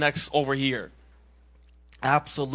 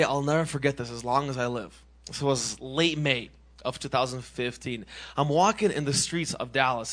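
usual speaking voice

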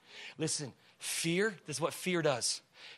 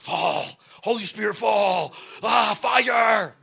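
slow, loud, high mock cry for fire; mocking a shouted revival prayer; mock hype prayer; 4 semitones higher, 8 dB louder, much slower than usual. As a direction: ham up a charismatic call. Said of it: "We think".

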